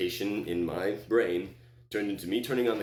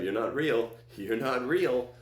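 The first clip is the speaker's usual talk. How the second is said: insistent; 5 semitones higher, 3 dB louder, slower than usual. Retold or imitated. Insisting while pointing at accusers.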